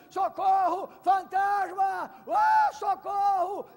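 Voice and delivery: high, loud, drawn-out panicked cry; scream of fear. Acted. Disciples screaming at the ghost, hammed-up fear. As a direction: yell for help in mock terror. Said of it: "começaram a gritar de medo".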